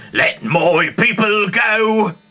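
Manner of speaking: high, loud and very slow proclamation; booming demand. Acted. Moses demanding the king free his people, booming. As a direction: thunder it out like a prophet.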